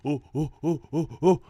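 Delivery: rhythmic hunting calls, rising at the end; hunters hooting. Acted. Humans chasing the zebra, hooting steadily. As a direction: hoot along like a pursuing caveman. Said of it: "And the humans go".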